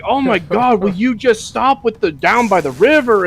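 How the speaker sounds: high, exasperated mock whine; high mock fed-up complaint. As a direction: groan it, fed up. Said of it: "He's like".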